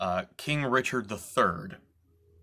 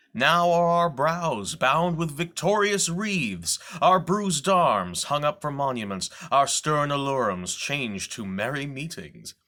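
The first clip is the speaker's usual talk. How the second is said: Shakespearean monologue, rising to a loud, high, ringing peak; theatrical declamation; 4 semitones higher, 4 dB louder than usual. Acted. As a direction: proclaim it to the back row of the theater, building in triumph. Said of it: "I'm going to do a reading".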